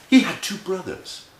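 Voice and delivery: usual speaking voice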